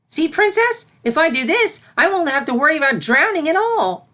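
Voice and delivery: put-on high, loud, proud young voice; beaming filly. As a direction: proudly present the result to her mentor. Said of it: "Sunset beamed at her mentor".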